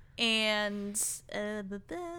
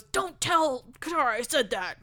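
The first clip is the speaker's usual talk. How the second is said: raised, emphatic imitation of a warning; mock warning; 4 semitones higher than usual. Toph to Sokka after admitting her feelings, warning. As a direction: act out a sudden order to keep a secret.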